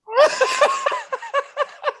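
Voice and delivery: high burst of laughter; loud high laugh; big laugh. Real, not acted. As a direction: crack up at the memory. Laughing at ugly photo.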